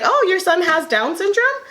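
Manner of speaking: high, cheerful caregiver welcoming the news; retold joyful nurse. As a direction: replay the nurse's happy, upbeat reassurance. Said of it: "so she's like".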